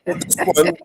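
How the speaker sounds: normal talking voice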